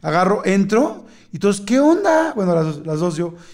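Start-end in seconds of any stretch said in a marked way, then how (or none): 1.6-2.3 high-pitched mock greeting; acted greeting. Waiting companions greet him as he returns, imitated high and expectant. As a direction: imitate a high, singing "what's up?".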